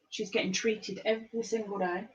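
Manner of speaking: normal talking voice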